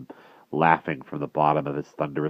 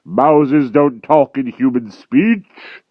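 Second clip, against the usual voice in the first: loud, slow, heavy giant voice; dim brutal giant; 10 semitones higher, 11 dB louder, much slower than usual. A narrator's reading. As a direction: drag each word out like a lumbering brute. Giant Gallopus scoffing.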